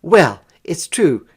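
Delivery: put-on loud, tearful girl's insistence; near tears. A narrator's reading. Girl near tears insists to her brother. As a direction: insist while close to crying. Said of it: "declared Nell Jean, close to tears".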